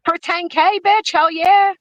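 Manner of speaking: squeaky high pitched voice; chipmunk-like squeal; high-pitched voice changer. Real, not acted.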